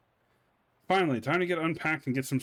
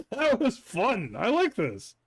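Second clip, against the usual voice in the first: delighted; 9 semitones higher, 3 dB louder than usual. Real, not acted. Player delighted after scene.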